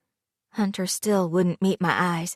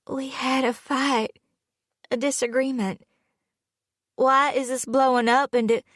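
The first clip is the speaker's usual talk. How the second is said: tearful; 6 semitones higher than usual. A narrator's reading. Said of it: "throat thick with tears".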